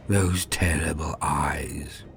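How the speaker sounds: plain reading voice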